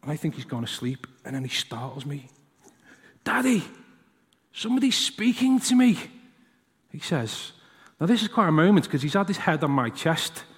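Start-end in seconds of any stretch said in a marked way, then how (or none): 3.2-6.0 put-on higher, hushed child voice; a small boy waking with news; sleepy boy, higher pitched; startled little boy.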